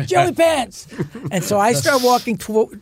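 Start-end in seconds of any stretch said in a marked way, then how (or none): 0.1-0.9 calling out higher. Retold or imitated. Harrison calls him again over the crowd, higher. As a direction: pitch the name up as if shouting over a crowd.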